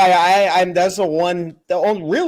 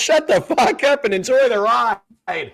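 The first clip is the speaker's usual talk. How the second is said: higher, mock-exasperated telling-off; fed-up driver; 7 semitones higher than usual. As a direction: play a driver losing patience with a kid. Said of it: "the driver is annoyed at his questions".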